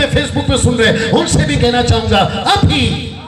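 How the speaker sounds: ordinary talk, nothing marked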